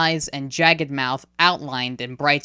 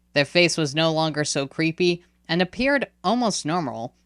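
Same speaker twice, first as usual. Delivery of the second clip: ordinary delivery, nothing marked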